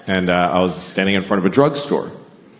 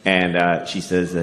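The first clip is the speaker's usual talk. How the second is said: normal talking voice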